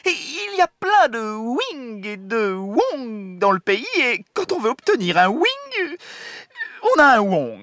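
high, slow, fussy officer; high general voice. Acted. General explains phone trouble.

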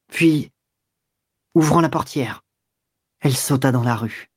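usual speaking voice

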